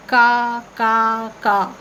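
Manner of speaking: cawing like a crow; crow call imitation. Shown as a demonstration. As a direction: imitate a crow's caw. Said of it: "another you can write".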